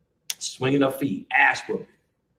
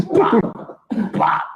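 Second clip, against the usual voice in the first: imitated hit sounds; 8 semitones higher, 3 dB louder than usual. Imitating the slingshot beating.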